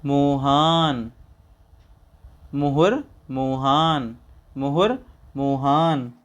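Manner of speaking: मुहुर and मोहान alternated slowly; recitation drill. Shown as a demonstration. Teacher alternating मुहुर and मोहान for students. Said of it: "आगे चलते हैं".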